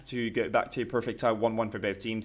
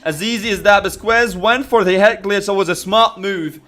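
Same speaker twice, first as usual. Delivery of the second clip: loud hyped play call; excited; 6 semitones higher, 11 dB louder than usual. Real, not acted. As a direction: punch the big shot loudly. Big shot called live.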